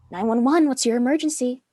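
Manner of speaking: a dispatcher answering a call through the receiver; phone operator. Acted. Sam calls emergency line.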